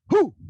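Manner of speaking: sharp grunt on a jump; football player grunt. Shown as a demonstration. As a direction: grunt hard like a linebacker leaping. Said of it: "grunt like a football player, like this".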